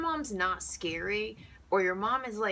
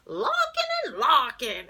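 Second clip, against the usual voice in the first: loud, high, slow cartoon holler; big drawn-out shouted phone greeting; the Wolf; 10 semitones higher, 9 dB louder, slower than usual. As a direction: answer the phone like a clown. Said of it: "he'd answer the phone".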